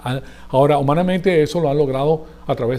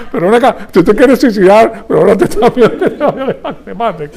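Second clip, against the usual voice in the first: incredulous mock retort; 5 semitones higher, 8 dB louder than usual. Colombian mocks suicidal friend.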